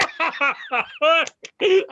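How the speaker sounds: high, loud burst of wild laughing; uncontrolled high laugh; manic laughter. Acted. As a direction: cackle helplessly after an outburst. Manic laugh after the outburst.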